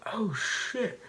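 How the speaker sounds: jumps up high in alarm; startled oh shit. Retold or imitated. Hunter startled when the turkey runs, high alarmed yelp. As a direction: caught off guard as the bird bolts.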